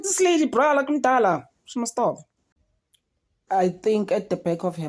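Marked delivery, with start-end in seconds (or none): 0.0-2.2 high, fed-up mock reaction; exasperated inner voice, pitched high. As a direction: scoff at her like she's had enough. Her own exasperated reaction quoted.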